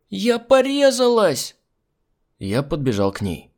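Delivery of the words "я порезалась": put-on high, loud, slow child's wail. A narrator's reading. Little girl complaining of a cut, high loud wail. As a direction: drag it out like a hurt kid.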